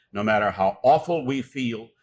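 usual speaking voice

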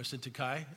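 Syntyche said as SIN-tuh-kye; pronounced ending in KYE. Shown as a demonstration. Preacher offering congregation a name variant, Syntyche ending in "kye". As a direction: say Syntyche with a final "kye", rhyming with sky. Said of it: "It's all pronounced all three different".

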